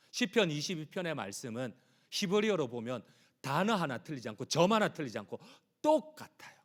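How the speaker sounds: usual speaking voice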